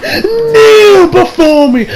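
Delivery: shrieking, slow, drawn-out scream; Zod. Retold or imitated. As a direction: scream the command at its peak, stretched long. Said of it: "Like she's fucking Zod out here".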